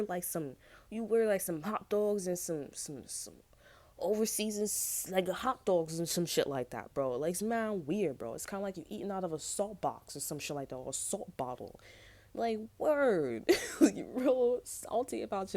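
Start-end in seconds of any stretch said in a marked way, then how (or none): 1.4-6.9 mock disgust. Real, not acted. Mocking weird food, drawn out. 12.3-15.5 higher, louder, slow and laughing; laughing mockery. Real, not acted. Vlogger mocking a salty friend, laughing.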